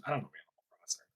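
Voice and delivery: quick whispered aside. Real, not acted. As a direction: whisper it fast under your breath. Quick aside after a question.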